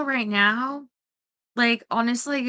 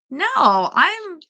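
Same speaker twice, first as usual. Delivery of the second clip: normal talking voice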